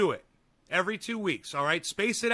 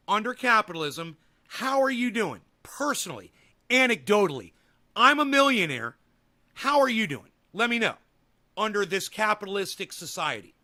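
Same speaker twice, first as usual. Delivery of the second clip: loud, high challenge; heated rant; 4 semitones higher, 7 dB louder than usual. Real, not acted. Streamer challenging a chatter about his wealth, loud and heated. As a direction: throw it back at him, fired up.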